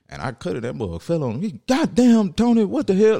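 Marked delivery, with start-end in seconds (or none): 1.7-3.2 loud, high cartoon-dad exclamation; King of the Hill. Dad hit by a falling branch, loud cartoonish outrage. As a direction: imitate an exasperated cartoon father, pitched up and loud. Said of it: "he sounded like, uh, uh, King of the Hill".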